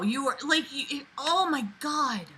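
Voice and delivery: usual speaking voice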